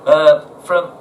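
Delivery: usual speaking voice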